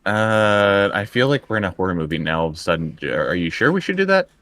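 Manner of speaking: ordinary talk, nothing marked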